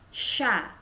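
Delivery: "Sha" with a soft sh, like Arabic sh; French ch as sh; soft sh sound. Shown as a demonstration. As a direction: hiss the sh gently.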